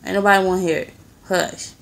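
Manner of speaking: loud telling-off, then a short hush; sharp scolding. Real, not acted. Owner tells the dog nobody wants to hear it, scolding. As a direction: shut the dog up firmly and loudly.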